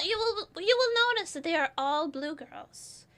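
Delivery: ordinary talk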